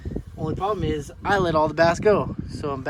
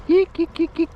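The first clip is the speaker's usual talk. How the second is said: fish call; 6 dB louder than usual. Real, not acted. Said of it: "I forgot to call for 'em".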